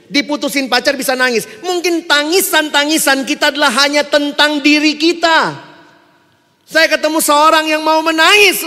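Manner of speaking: loud, high, forceful sermon delivery; impassioned preaching. Real, not acted. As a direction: press the challenge on the congregation at full force.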